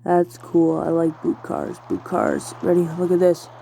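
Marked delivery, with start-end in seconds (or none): 1.9-2.5 deep voice. Real, not acted. Admiring the boot cars, repeats it in a deep voice.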